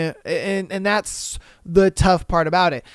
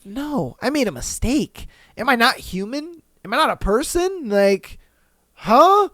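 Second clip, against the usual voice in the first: higher-pitched, disbelieving questions; incredulous protest; 5 semitones higher than usual. Retold or imitated. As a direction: act out pleading bafflement at being blamed. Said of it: "and I'm like".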